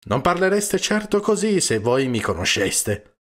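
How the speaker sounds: loud, high, wounded protest; bitter. A narrator's reading. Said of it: "non riuscì a nascondere la propria amarezza".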